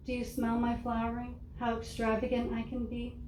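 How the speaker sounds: normal talking voice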